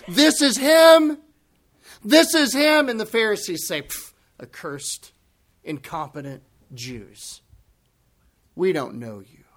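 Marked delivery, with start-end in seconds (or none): 0.1-2.9 high, loud excited proclamation; yelling out. Acted. Crowd members recognizing the Christ, yelling out. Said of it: "they're starting to yell out". 3.8-9.4 low, soft dismissal with pauses; mildly scornful, write them off quietly; pejorative. Acted. Pharisees dismissing the crowd as accursed, pejorative. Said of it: "a pejorative way that the rabbis would speak".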